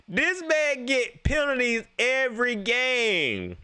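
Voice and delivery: high, slow, drawn-out complaint; comic exasperation. Real, not acted. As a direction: stretch it out in high-pitched disbelief. Mock exasperation over penalties.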